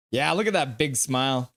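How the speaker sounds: ordinary delivery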